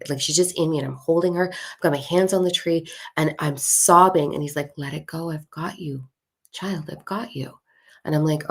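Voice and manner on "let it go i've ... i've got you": soft, tender reassurance; soothing. Retold or imitated. Willow tree to the sobbing woman, soothing. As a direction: comfort gently in a hushed voice.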